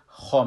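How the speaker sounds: خا said full with rounded mouth; heavy kha. Shown as a demonstration. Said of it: "وقتی که فتحه داشته باشه".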